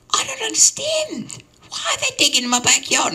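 very high, squealing mock-old-lady voice; high-pitched old woman in comic distress; distraught old lady. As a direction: squeal it in hammed-up bewilderment. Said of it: "this old lady came out screaming".

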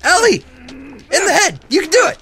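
loud, high-pitched pleading yells; frantic shouts of encouragement; desperate urging. Real, not acted. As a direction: cheer your partner on in a panic. Desperately urging Ellie to shoot.

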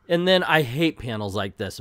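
ordinary talk, nothing marked

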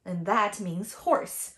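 ordinary talk

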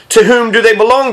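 touchy, irritated comeback; peevish retort. A narrator's reading. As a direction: retort peevishly, pitch jumping up. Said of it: "the businessman retorted peevishly".

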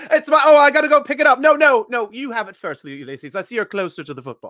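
high, fast pompous gent; hammy British politeness; posh British voice. Pretend. Partner joins posh voice.

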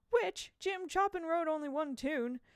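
loud, high incredulous outburst; exasperated disbelief. A narrator's reading. Boy answering his friend's obvious question, exasperated. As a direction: cry it out, appalled he has to ask.

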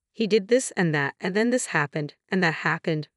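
usual speaking voice

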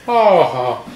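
loud mock Irish brogue; Irish accent. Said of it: "my bad Irish accent".

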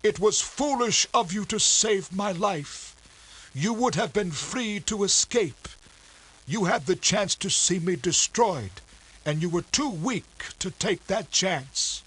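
high, strained voice of a dying captain; a proud, hurt sea captain, bitter; wounded captain. A narrator's reading.

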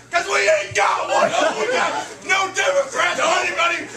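high-pitched, worked-up comic tirade; mock rant. Host ranting about America to the crowd, mock tirade.

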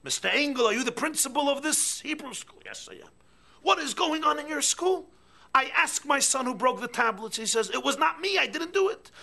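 very loud, high outraged shouting; an infuriated parent yelling at the principal. Acted. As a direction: storm in and demand answers. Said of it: "An infuriated parent".

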